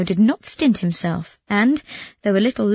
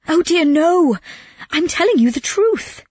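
high, louder wail of protest; distraught Calandrino; 9 semitones higher, 4 dB louder than usual. Acted. Robbed man insists to his doubting friend, distraught.

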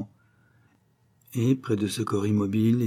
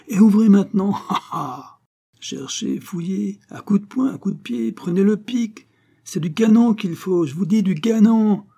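high, loud crowing with a laugh; gleeful taunting; 10 semitones higher, 5 dB louder than usual. A narrator's reading. Old locksmith taunting Malouin to force the door, gleeful. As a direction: jeer at him, exulting in the lock.

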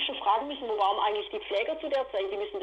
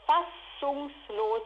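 fassungslos stretched very slowly, each syllable held; drawn-out word; 2 semitones higher, much slower than usual. Real, not acted. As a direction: let fassungslos hang slowly in disbelief. Word repeated, first drawn out.

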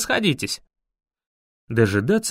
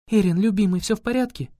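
higher, caring female voice; worried tender girl; 5 semitones higher, faster than usual. A narrator's reading. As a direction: anxiously lean over him. Laila worried over fallen Erin.

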